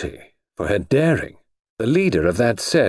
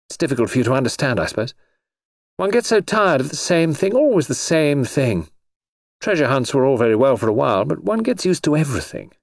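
put-on higher-pitched, weary of the same old thing; lightly voiced bored socialite; 6 semitones higher than usual. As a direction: play a jaded society girl, pitched up. Voices Noreen confiding in Edward.

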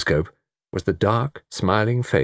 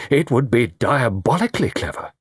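raised, emphatic marvelling; deliberate, impressed realisation; slow, dawning appreciation; 5 semitones higher than usual. A narrator's reading. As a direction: relish each syllable as the idea grows. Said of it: "said Mister Satterthwaite slowly".

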